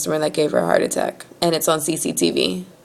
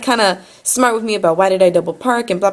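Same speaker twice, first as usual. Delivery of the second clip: normal talking voice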